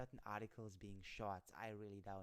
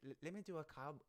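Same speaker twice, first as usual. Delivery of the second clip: ordinary talk, nothing marked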